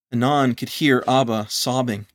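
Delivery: usual speaking voice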